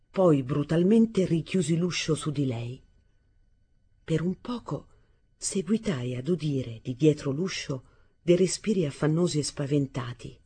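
ordinary delivery, nothing marked

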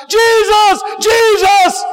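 high, loud desperate call; crying out. Retold or imitated. Believer calling for Jesus, loud high desperate cry. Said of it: "we need to cry out".

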